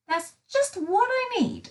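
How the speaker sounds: bright, high delighted line; thrilled at finding the submarine; excited. A narrator's reading. Little Miss Sunshine thrilled at the submarine, excited. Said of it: "She was very excited at the sight".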